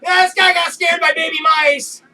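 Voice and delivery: loud, high teasing announcement. Retold or imitated. Coworkers telling everyone inside, a loud teasing shout. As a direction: broadcast his shame, loudly and gleefully.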